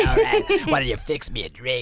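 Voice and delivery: lightly voiced gruff man; mock creepy voice; hammed-up creepy dad voice; raspy impression. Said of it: "Charles Manson impression".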